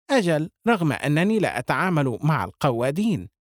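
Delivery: plain reading voice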